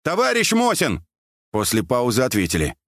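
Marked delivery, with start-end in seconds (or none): 0.0-1.0 high call into the distance; raised, hailing cry. A narrator's reading. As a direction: shout to someone far away. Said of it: "и крикнул наугад".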